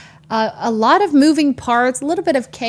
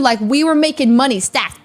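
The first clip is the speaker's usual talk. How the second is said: usual speaking voice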